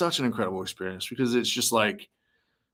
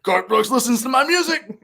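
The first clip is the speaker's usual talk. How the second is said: higher, louder, thrilled disbelief inside his head; giddy inner voice; 14 semitones higher, 5 dB louder than usual. Retold or imitated. Fan reliving meeting his hero, higher and thrilled. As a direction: squeal it to yourself like a starstruck fan. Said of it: "And inside I'm like".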